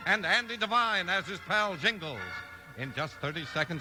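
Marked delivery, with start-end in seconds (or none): 0.1-2.2 big, bright announcer read, pitched up; booming show opener; radio announcer. Acted. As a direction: sell it like a radio host.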